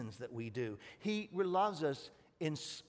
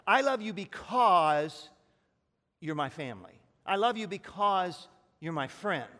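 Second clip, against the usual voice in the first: louder mock declaration of family love; emphatic quote; 3 semitones higher, 8 dB louder than usual. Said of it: "but it's like".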